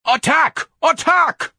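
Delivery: very high, loud furious yell. Acted. Ignatius urging his battalion on, furious crying. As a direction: yell the order in a rising fury. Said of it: "even more furiously".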